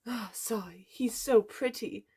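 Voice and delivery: slow, whispery swooning; besotted girl mooning over a crush; lovestruck sigh. A narrator's reading. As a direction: sigh it out dreamily, half whispered. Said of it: "she said out loud".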